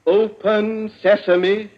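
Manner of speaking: very slow, loud, deliberate with rising end; slow, loud command. Acted. Wimsey enunciating to the voice lock, slow command. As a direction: spell every syllable out for the machine. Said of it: "If I can only be conversational".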